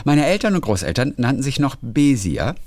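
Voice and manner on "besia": "Besia" with the first syllable stressed. Shown as a demonstration. Letter writer's family name as the grandparents said it, first-syllable stress. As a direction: say "Besia" German-style, weight on the opening BE. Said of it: "mit Betonung auf der ersten Silbe".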